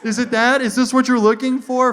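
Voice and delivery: high, loud flustered questions; frantic, eager-to-comply questioning; nervous. Retold or imitated. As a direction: imitate panicking while handing over papers. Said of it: "I get nervous".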